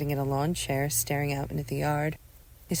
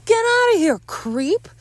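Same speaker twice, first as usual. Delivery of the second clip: high, loud insult; shouted, high-pitched dismissal; angry yell; 9 dB louder, slower than usual. Acted. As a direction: yell it to drive them off. Chasing intruder away.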